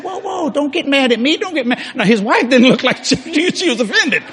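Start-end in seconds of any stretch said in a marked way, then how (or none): 0.0-1.8 high-pitched feigned innocence; mock placating. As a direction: fend off an angry husband in a high voice. Acting out calming an angry husband.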